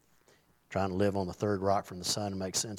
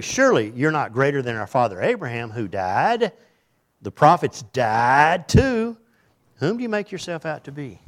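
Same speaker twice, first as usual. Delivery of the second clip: loud, higher challenge; preaching at full force; 4 semitones higher, 7 dB louder than usual. Acted. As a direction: hurl the crowd's challenge. Voicing accusers, loud.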